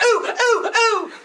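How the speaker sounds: high mock eager ooh ooh; hammed-up eager student. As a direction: squeal like a kid begging to be picked. Teacher mimics eager hand-raiser.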